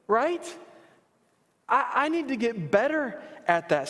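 high, fervent preacher's rebuke; impassioned preaching. Acted. Pastor's self-rebuke at raised pitch.

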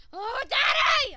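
put-on loud, high child's yell; drawn-out shout for a parent; calling for Daddy.

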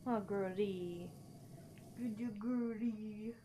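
slow, tender talk to a grumpy cat; drawn-out fond pet talk; slow cooing. Real, not acted.

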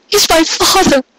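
high, tearful cry; voice breaking. Acted. Cupcake to Carrot, crying out, voice breaking. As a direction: call it out with the voice cracking. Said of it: "her voice breaking".

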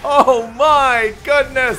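high, loud, drawn-out exclamation; real high-pitched cry of disbelief; amazed shout. Real, not acted. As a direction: yell it slowly in sheer amazement. Amazed at the match's outcome.

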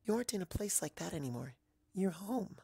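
soft high pony reassurance; gentle comfort. Acted. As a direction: ease her fears softly and quickly.

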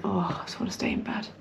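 breathy whisper, barely voiced. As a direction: whisper it like a sleepy groan. Reluctant inner thought.

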